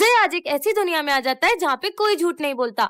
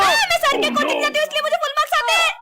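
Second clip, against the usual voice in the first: fast, higher blurted truth; blurted confession; 6 semitones higher than usual. Acted. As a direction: rattle off the secret fast. Forced confession by magic belt.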